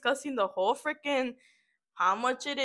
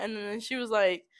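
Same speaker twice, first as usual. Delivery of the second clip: usual speaking voice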